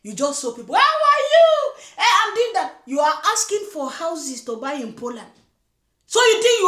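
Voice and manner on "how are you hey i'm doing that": mock bubbly greeting. Vlogger mimicking the girlfriend greeting strangers, high mock gush. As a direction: gush at strangers like an overeager newcomer.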